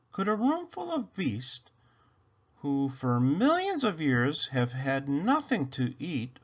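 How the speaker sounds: ordinary delivery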